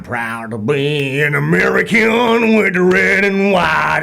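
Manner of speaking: put-on American twang; attempted patriotic American accent. Shown as a demonstration. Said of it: "can you do an American accent or no".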